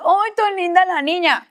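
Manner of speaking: imitando una exclamación de ternura, aguda; hermanos de iglesia encantados. Retold or imitated. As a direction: imita a los hermanos admirando a una bebé. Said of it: "y los hermanos". (halago agudo y mimoso imitado)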